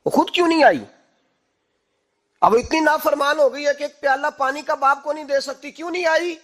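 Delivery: high, loud angry demand; angry father. Retold or imitated. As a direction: scold the absent daughter sharply. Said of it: "عمر بن عبدالعزیز کو غصہ آیا". (ناراض باپ کی اونچی غصیلی آواز)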